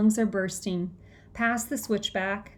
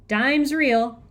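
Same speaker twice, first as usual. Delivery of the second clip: loud, higher-pitched announcement; raised voice, declaring it; announcing; 4 semitones higher, 6 dB louder than usual. A narrator's reading. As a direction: announce it crisply to the room. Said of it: "she announces".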